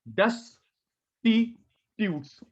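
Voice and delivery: डेस्टिट्यूट्स drawn out very slowly, each syllable apart; slow split pronunciation. Shown as a demonstration. Teacher drilling a new word for the class, slowly split. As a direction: stretch डेस्टिट्यूट्स into separate syllables for students. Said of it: "split करके बोलो".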